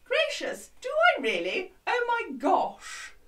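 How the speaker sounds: high, plummy posh lady; hammed-up posh lady exclaiming. Shown as a demonstration. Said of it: "Gonna talk real posh".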